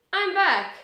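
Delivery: bright, excited announcement. Real, not acted. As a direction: proudly announce your return. Video comeback announcement.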